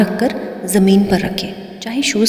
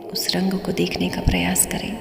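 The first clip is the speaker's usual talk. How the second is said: guided meditation; 5 dB quieter than usual. Acted. Guide leads listeners to close their eyes and observe, calm meditative delivery. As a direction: ease each phrase out gently, leaving room between.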